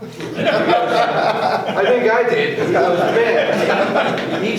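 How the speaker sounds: can't hold back the laugh; cracking up mid-story. Real, not acted. Laughing through the retelling.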